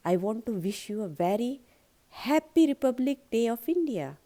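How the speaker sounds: wishing the school a happy Republic Day, slow and building; stress on happy Republic Day. Shown as a demonstration. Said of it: "उस special दिन पे जोर देना है".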